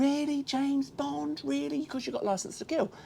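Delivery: high, slow, drawn-out disbelief; mock incredulous. Fan mocking Bond, high and slowly incredulous. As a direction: ask Bond in exaggerated disbelief. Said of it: "you sort of go".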